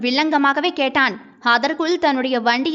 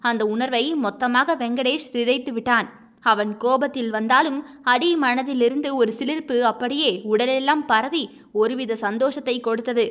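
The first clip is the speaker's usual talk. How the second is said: plain reading voice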